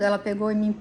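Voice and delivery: ordinary delivery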